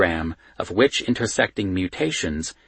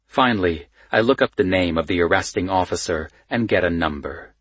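plain reading voice

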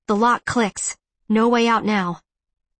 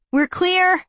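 high-pitched call to someone above; 9 semitones higher than usual. A narrator's reading. Calling up to Evan at the grate, high call. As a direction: call it upward, lifted and held. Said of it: "I call up to him".

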